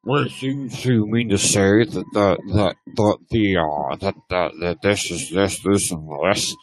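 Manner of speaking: low, halting, muddled voice; McNabb. Acted. Mocking a rival's rambling remarks, low bumbling imitation. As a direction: stammer it low, mocking a dim talker. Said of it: "You sound like Meat Nab when you're doing his voice".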